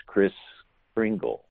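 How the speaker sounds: Chris Kringle, slowly split into two words; altered pronunciation. Shown as a demonstration. Explaining how the name changed over years, Chris Kringle slowly separated. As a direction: say Chris, pause, then Kringle with a gl ending. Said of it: "got pronounced".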